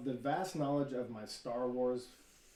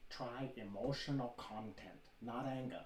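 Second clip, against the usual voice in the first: Bruce Lee impression; 6 dB quieter, slower than usual. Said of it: "wicked Bruce Lee impersonation".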